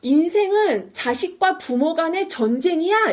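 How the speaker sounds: high, drawn-out mock declaration; slow, emphatic imitated outcry; acted yelling. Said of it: "갑자기 소리를 지릅니다".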